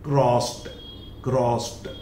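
क्रॉस्ड said as "krost"; क्रॉस्ड closed on ट, not ड; final t sound. Shown as a demonstration. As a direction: repeat क्रॉस्ड with a clean t ending. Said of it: "not crossed".

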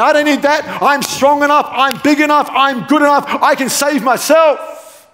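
high, insistent self-reliant bragging; mock boasting. Preacher mimicking someone too proud to be saved, high and boastful. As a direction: build a defiant list of boasts, pitched well up.

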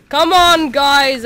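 loud, high rallying call; urging shout. Pretend. Player urging warriors to jump in, urging shout. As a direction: yell to get the guys moving.

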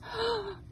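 sudden high gasp, feet burning; sharp gasp of pain. Real, not acted. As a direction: gasp as the hot ground bites. Feet burning on hot ground.

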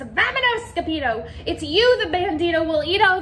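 high frightened crying out; panicked cry. Acted. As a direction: flee in panic. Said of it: "they cried".

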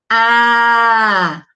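loud, held "A" for the class; vowel sound A. Shown as a demonstration. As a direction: call out a long open "A". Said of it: "Olha só o som".

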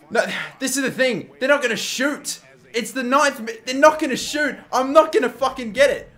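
high-pitched, frustrated complaint; exasperated protest. Real, not acted. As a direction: protest helplessly, pitch pushed right up. Realizes he will miss the assist.

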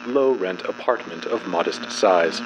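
ordinary delivery, nothing marked

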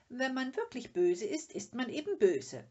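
high, loud matter-of-fact verdict; pert little know-it-all. A narrator's reading. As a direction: lay down the rule pertly. Little My states her view. (vorlaut und bestimmt)

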